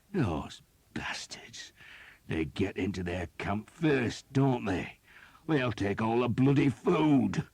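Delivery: from slow whisper building to a high, loud complaint; resentful outburst. A narrator's reading. Skeet grumbling to Thomas about looters, building from whisper to loud. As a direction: start hushed and let the grudge boil over.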